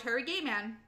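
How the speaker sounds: plain reading voice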